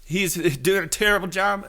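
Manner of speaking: high, nasal-free mock whine of a critic; hammed-up whiny critic. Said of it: "everyone wants to be like".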